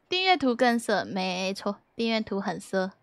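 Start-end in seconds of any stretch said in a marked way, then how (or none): none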